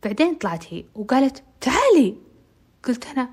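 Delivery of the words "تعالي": high, loud summons; snappy high come here; bossy call. Retold or imitated. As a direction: call her over sharply. Amal calls her to come.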